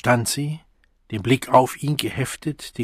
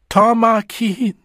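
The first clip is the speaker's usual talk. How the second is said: much higher, drawn-out wail; anguished cry; 11 semitones higher, 6 dB louder, slower than usual. A narrator's reading. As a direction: cry out in misery. Desperate cry, arms spread.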